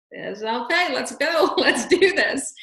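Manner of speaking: laughing cheer. Retold or imitated. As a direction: cheer her on while laughing. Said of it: "I'm like".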